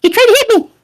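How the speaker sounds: very high, loud tiny creature; squeaky voice. Acted. Second water creature accuses Simon, squeaky. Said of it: "one little squeaky voice going".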